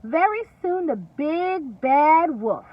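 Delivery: ordinary talk, nothing marked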